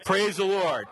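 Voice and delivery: fervent Praise the Lord; with feeling. Shown as a demonstration. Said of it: "Say it with feeling".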